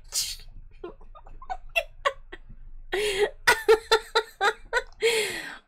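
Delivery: long high-pitched giggling fit; real delighted laughter. Real, not acted. Learner amused by the whip-like pronunciation, delighted laughter. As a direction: dissolve into high, helpless giggles. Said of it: "this is too fun".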